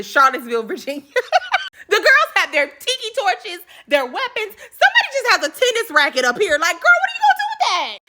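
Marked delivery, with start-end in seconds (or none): 1.1-1.7 sudden squeaky laugh; high-pitched giggle at her own joke; high burst of laughter. Real, not acted. 6.6-8.0 much higher, incredulous sass; sky-high mock disbelief; sassy mock disbelief. Real, not acted. Mocking girl with tennis racket.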